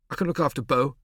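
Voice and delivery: put-on loud, defiant boy's retort; angry boy retort. A narrator's reading. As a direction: snap back hurt and defiant. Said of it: "he retorted angrily".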